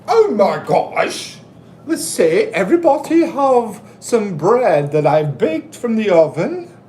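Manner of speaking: high, far-off granny voice offering bread; Mrs. Doubtfire impression. Robin Williams as nanny offering bread to guests. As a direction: play a fussy nanny serving bread. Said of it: "It's a Robin Williams movie".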